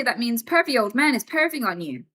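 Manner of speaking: ordinary talk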